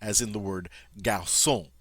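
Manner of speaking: garçon said gar-son, soft C like S, nasal final on; French garçon, soft S and nasal on. Shown as a demonstration. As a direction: say garçon with an S, not a K. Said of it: "a soft C sound".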